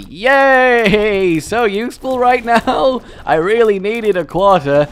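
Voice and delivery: loud, high mock delight; sarcastic cheer. Real, not acted. Sarcastic joy at a quarter.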